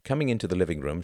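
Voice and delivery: plain reading voice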